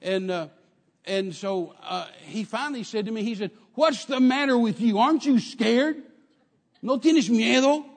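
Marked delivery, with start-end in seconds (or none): 3.7-7.9 loud, high, slow imitation of an exasperated gunman; shouted demand. Gunman baffled that his hostage isn't scared, shouted. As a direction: holler the question in disbelief, dragging it out.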